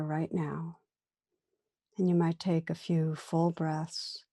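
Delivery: calm meditation guide, long pauses between phrases; guided meditation. Real, not acted. Teacher inviting listeners to turn attention inward, guided meditation. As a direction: gently lead listeners inward, unhurried, leaving room after each phrase.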